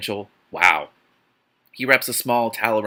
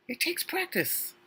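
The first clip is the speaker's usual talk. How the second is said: much higher, quiet, mocking little voice; sarcastic aside; 9 dB quieter, slower than usual. Real, not acted. Reader mocking the heroine's sudden skill in a tiny voice. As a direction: squeak it out in mock wisdom.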